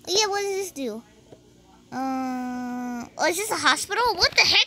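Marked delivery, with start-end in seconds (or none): none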